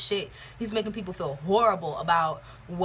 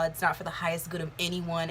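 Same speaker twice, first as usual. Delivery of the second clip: ordinary talk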